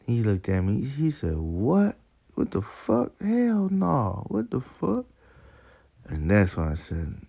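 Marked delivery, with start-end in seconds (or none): 1.4-5.1 high-pitched imitation of outrage; mock mad, upset. Man outraged at a question about his belief, mock mad and high-pitched. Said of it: "got so mad and upset".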